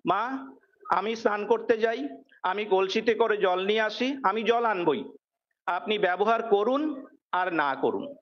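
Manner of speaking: ordinary talk, nothing marked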